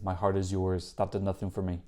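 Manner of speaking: usual speaking voice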